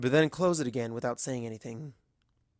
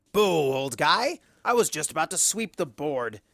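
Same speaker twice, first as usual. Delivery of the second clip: mocking boo; 3 semitones higher, 5 dB louder than usual. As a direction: jeer like a sore loser. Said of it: "says Discord".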